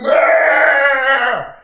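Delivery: loud high yelling scream as he hits the puddle; pained scream. A narrator's reading. Thrown into a puddle, man screams loudly. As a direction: let out a big splashing howl. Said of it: "They splash and they scream".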